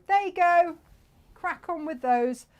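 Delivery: loud, high, breezy send-off; breezy retold handover. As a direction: toss it out brightly and loudly, imitating yourself. Acting out handing fabrics to Jane.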